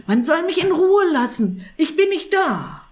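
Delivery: high, loud, frantic wail; panicked cry. A narrator's reading. Wizard hiding from the knocking, a loud panicked wail. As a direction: cry out in panic with your ears covered.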